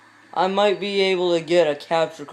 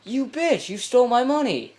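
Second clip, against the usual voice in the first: high-pitched indignant cry at the thief; outraged yell; 9 semitones higher, 4 dB louder than usual. Real, not acted. Robbed gamer yelling at the thief, high and outraged. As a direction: yelp it out, robbed and furious.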